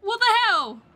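high, loud cry of disbelief; startled shout. Real, not acted. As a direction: burst out loud, caught off guard. Startled again in game.

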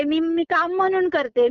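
usual speaking voice

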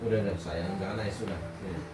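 soft, low comforting hush; soothing. Real, not acted. Father comforts crying daughter. (menenangkan dengan lembut dan pelan)

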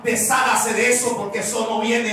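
high, loud sermon voice; triumphant pulpit shout; fiery preaching. Acted. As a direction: preach it with everything you have. Preaching at full force.

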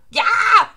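high, loud burning yell; acted scream. Villain catching fire, high acted scream. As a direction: scream as if set alight. Acting Orochi being burned.

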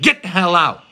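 imitated angry priest ordering someone out; harsh dismissal. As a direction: throw him out with a loud command. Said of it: "He just said".

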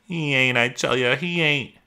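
high, upset protest; pleading denial. Acted. Lenny shuts out the rabbit's taunts, pleading.

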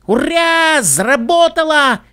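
high, drawn-out cheer; excited shout. A narrator's reading. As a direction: cheer in triumph, stretching the words out high. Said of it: "крикнула Скуталу".

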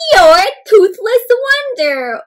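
high, loud, stretched-out teasing; amused mother. A narrator's reading. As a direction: tease your child, drawing it out. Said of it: "Froggy's mother laughed".

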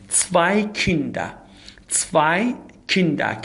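very slow model of "Zwei Kinder", zw said as ts; slow repeat-after-me. Shown as a demonstration. Teacher asks students to repeat after, slow model phrase. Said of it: "شما حتماً تکرار کنید همراه من".

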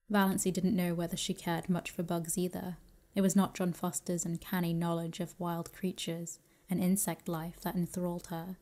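usual speaking voice

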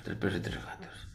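whispered aside, no voice; whispering. Shown as a demonstration. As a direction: breathe the count under your breath. Repeats count, then whispers it.

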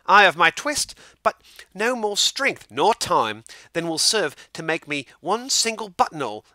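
put-on high, loud anxious old man; fretting old tailor. A narrator's reading. Tailor frets to Simpkin about the Mayor's coat, high and loud. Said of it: "said the tailor".

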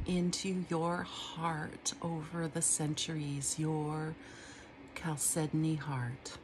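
slow, hushed, trance-like reading; dream poem reading. Acted. Reading her dream poem aloud, slow and hushed. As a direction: sink into it, slow, nearly whispering.

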